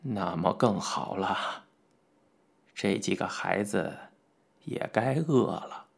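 put-on slow, low, soft elderly voice; deep, hushed, indulgent elder; kindly smiling old gentleman. A narrator's reading. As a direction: speak gently and unhurriedly, with an old man's smile. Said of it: "傅老爷子笑道".

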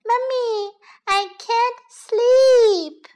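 put-on high, slow, singsong child wail; young bunny calling. A narrator's reading. Second youngest bunny calling snoring Mummy, high drawn-out whine. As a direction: call out high, stretching the last word. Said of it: "youngest bunny call".

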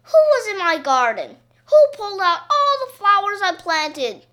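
loud, high furious outburst; angry shout. A narrator's reading. Mister Dean yelling about his pulled flowers, angry shout. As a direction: shout it at the ruined garden. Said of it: "he began to shout".

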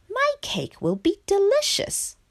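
very high-pitched frog voice; confident frog. A narrator's reading. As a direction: boast about it in a high frog voice. Frog proud of his cake.

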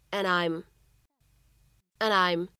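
"and I'm" linked as "an-dI'm"; linking. Shown as a demonstration. Teacher showing learners the linked "and I'm". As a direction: slide the d of "and" into "I'm". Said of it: "我们可以读成".

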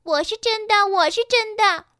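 high, loud insistent shouting; clamouring. A narrator's reading. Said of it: "每匹碧琪都叫喧着". (高声叫嚷着争辩)

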